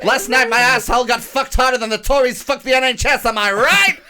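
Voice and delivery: high, loud, hammed-up pundit; Owen Jones. Sending up Owen Jones ranting about the Tories, hammed-up impression. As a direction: belt out a mock crowd-rousing rant. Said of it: "Such a good Owen Jones impression".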